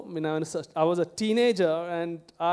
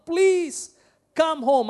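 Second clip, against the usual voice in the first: slow, loud, high begging; loud plea; 7 semitones higher, 6 dB louder, much slower than usual. Retold or imitated. Royal official begging Jesus to come, loud plea. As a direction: cry out the plea, drawn out. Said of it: "The rich man again pleads".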